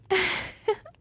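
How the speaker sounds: short, high vampire giggle; gloating giggle. Acted. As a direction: giggle in victory at your thrall. Gloating over thrall.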